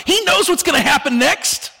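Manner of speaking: put-on a bewildered child asking why; mock-confused kid's outburst; confused young self. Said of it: "I thought".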